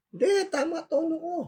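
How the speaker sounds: very high, indignant comeback; exaggerated high-pitched denial; mock defensive protest. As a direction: imitate someone insisting they got it right. Acts out defensive reply to criticism.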